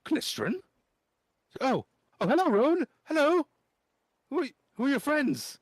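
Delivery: very high, loud excited greeting; boy squealing at a friend. Acted. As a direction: gush with delight. GM voices excited Klistron greeting.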